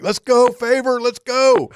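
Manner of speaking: impatient calling out. Retold or imitated. Crew member hurrying the captain off the dock: high urging call.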